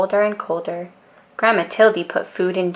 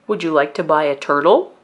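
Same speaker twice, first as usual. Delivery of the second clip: pet man; 3 semitones lower, faster than usual. A narrator's reading. Pet man offers turtle.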